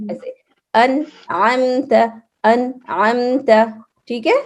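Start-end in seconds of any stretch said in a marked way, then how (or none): 0.7-3.5 انعمت slow, clear a then separate ain, an-ʕamt; model pronunciation. Shown as a demonstration. Teacher models the two sounds for the pupil. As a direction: say انعمت slowly, ain distinct. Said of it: "اس میں بالکل الف کی sound آئے گی".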